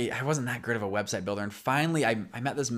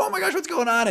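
much higher startled cry of alarm; alarmed wife; 3 dB louder than usual. Retold or imitated. Wife turning around to find husband choking, alarmed high cry. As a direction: voice the wife jumping in panic. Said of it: "she starts freaking out".

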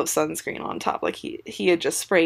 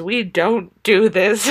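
slow, louder mock reprimand; dreaded parental scolding; 3 semitones higher, 6 dB louder, slower than usual. Mom rushing her son to the bathroom, slow firm scolding. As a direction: spell it out firmly like a scolding parent. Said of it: "saying the dreaded phrase".

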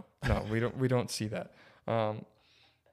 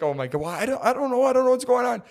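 flustered; 10 semitones higher, 4 dB louder than usual. Retold or imitated. Flustered reply retold.

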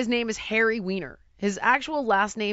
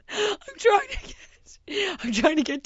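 panicked; 9 semitones higher than usual. Retold or imitated. Panicked retelling.

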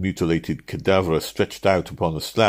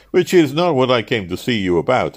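nettled, higher and louder reply; brusque; 7 semitones higher, 6 dB louder than usual. A narrator's reading. Traveller nettled by the clerks' incomprehension, brusque. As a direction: answer with irritated emphasis. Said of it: "I answered brusquely, somewhat nettled".

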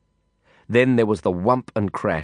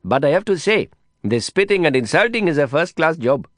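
put-on high Indian-accented voice giving earnest praise; admiring Indian friend; 6 semitones higher than usual. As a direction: play the impressed companion, pitched up. Same friend voice continues.

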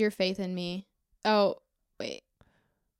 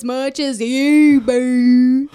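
louder, slow Southern drawl; Southern accent; 3 semitones higher, 7 dB louder, much slower than usual. Pretend. On request she redoes the line in a Southern accent. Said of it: "should have said that in a Southern accent".

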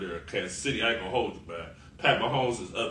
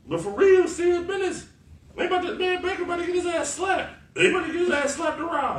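much higher, a squeaky put-on voice; mock serious; 4 dB louder than usual. Real, not acted. Telling Baker's fans he gets slapped, in a high mock voice. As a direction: squeak it out like a joke warning.

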